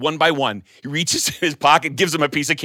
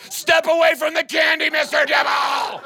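shouting; 12 semitones higher than usual. Acted. Principal yells, candy panic.

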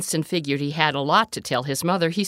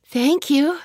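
cheerful little frog; 9 semitones higher, 5 dB louder, slower than usual. A narrator's reading. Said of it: "Winston waved at Miss Bird and said".

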